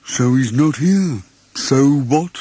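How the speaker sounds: slow, dismissive donkey voice; gloomy Eeyore. Acted. Eeyore shrugs off missing Tigger, slow and gloomy. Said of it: "Eeyore said gloomily".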